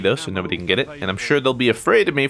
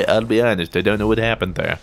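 ordinary delivery

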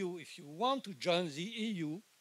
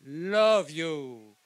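louder and slow, each word weighed; slow emphatic declaration; 2 semitones higher, 5 dB louder, slower than usual. Real, not acted. European speaker telling Scottish rally Europe loves them, slow and louder. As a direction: proclaim it to the whole crowd, slowly.

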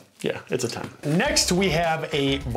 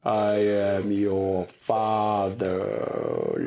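very slow, lower voice-changer villain; movie villain voice; 5 semitones lower, much slower than usual. Pretend. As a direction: drag out the menacing reveal. Famous movie villain line, voice changer.

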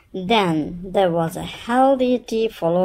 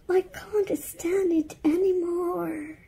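high, breathy whisper; whisper to herself; 12 semitones higher, 4 dB quieter than usual. Acted. Mother alone at night, whispering to herself. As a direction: whisper it in desperation. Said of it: "she said to herself".

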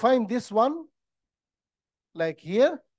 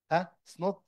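normal talking voice

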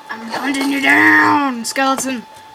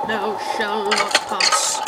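wizard voice; 4 semitones lower, much slower than usual. Pretend. Gandalf-style quote while playing.

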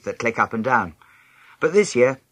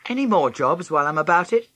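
high, louder mock-helpful question; sarcastic; 9 semitones higher, 4 dB louder than usual. A narrator's reading. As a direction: ask with heavy sarcasm to Mum. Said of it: "I asked sarcastically".

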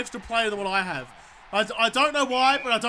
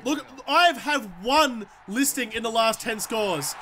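normal talking voice

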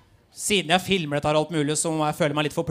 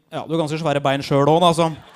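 mock teen boy retort; 4 semitones lower, 7 dB louder than usual. Boy mocking girl on bus.

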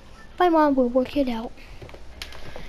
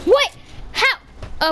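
shocked disbelief; 8 semitones higher than usual. Real, not acted. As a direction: blurt it out, can't believe it. Shocked at unfair game moment.

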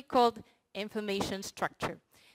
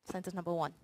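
ordinary talk, nothing marked

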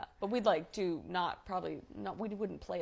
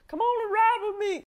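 playful quote; 13 semitones higher, 10 dB louder than usual. Retold or imitated.

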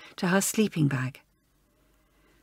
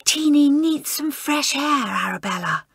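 hissed; 6 semitones higher than usual. Acted. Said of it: "hissed Joan".

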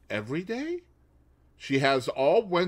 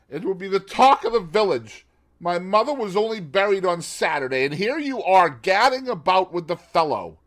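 loud angry reproach; 7 semitones higher, 8 dB louder than usual. Acted. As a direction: scold her hotly. Husband's angry accusation.

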